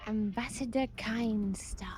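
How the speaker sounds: put-on slow, husky whispered Khajiit accent; bad Khajiit accent. Player introduces their ambassador in a bad Khajiit accent. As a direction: whisper it slowly in a badly faked Khajiit accent. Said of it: "bad Khajiit".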